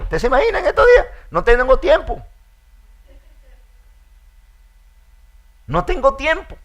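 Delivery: preacher mocking the too-busy believer, laughing; mock busy complaint. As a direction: laugh while faking the frantic busy excuse. Mocking busy believer refusing the Spirit.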